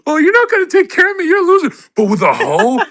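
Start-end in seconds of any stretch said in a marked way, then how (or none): 0.0-1.7 high, fast, whiny put-on girlfriend voice; mock nagging girlfriend. Girlfriend scolding her boyfriend over drugs, high mocking whine. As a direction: nag him like a fed-up girlfriend, high and quick.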